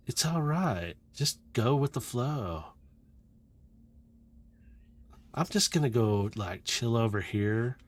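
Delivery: lightly voiced laid-back dude; drifting dude voice. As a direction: brush it off, easygoing and unhurried. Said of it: "the voice kinda drifts in and out".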